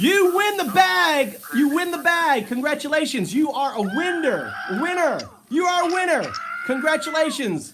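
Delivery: high, loud game-show celebration; mock prize announcer. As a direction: hype up a fake winner, drawing out the words.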